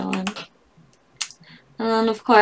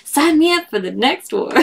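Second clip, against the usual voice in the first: excited grandma; 7 semitones higher, 3 dB louder than usual. Acted. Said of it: "grandma's like".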